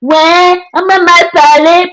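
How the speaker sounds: high, drawn-out mocking whine; imitated aggrieved protest, high and slow; mock complaint. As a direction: mimic people moaning, pitched well up. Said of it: "On va vous dire ça".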